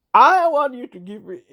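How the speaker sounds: ordinary talk, nothing marked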